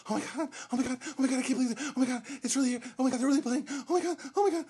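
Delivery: high breathless fan gushing; hyperventilating fan. Retold or imitated. Mocking a concert girl losing it over the band, high gushing. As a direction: gush like a fangirl about to faint. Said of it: "literally going".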